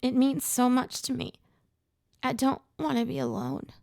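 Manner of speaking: high, voice cracking with emotion; tearful, breaking voice; cracked voice. Acted. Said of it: "Her cracked voice broke my heart".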